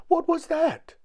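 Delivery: very high, loud squeal of panic; terrified shriek. A narrator's reading. Mister Jelly hears a snore in the grass, panicked shriek. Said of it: "shrieked Mister Jelly".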